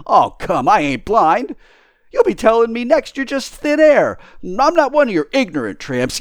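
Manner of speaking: high, loud, indignant tramp; scoffing tramp. Acted. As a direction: refuse to be fooled, loudly.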